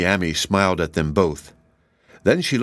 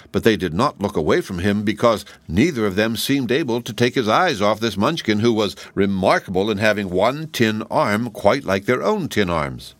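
ordinary delivery, nothing marked

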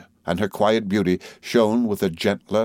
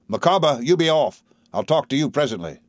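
higher-pitched order, then easing off; curt dismissal; 7 semitones higher than usual. A narrator's reading. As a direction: send an underling away. Uriah orders Micawber away.

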